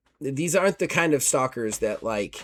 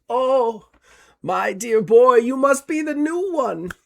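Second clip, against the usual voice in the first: put-on high, genteel old man voice; kindly old man; 10 semitones higher, 4 dB louder than usual. GM voicing the old man greeting the new arrival, high genteel old gent. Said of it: "clasps his hands together and goes".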